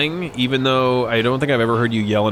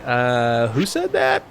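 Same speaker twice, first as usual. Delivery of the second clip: mock evasive dodge; 7 semitones higher, 3 dB louder than usual. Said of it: "You're like".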